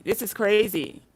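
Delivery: ordinary talk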